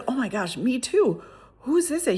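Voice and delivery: high-pitched, eager retold reply; worked-up agreement. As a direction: chime in, voice climbing into the question. Retold excited reply to stranger.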